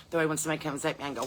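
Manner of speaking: usual speaking voice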